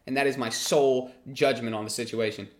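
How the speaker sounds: ordinary talk, nothing marked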